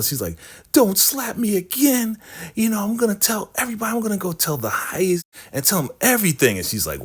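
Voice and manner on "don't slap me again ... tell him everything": high, fast, hammed-up protest; comic wounded outrage in a high voice; indignant tattling threat. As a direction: imitate someone slapped and threatening to tell. Acted quarrel, threat to tell.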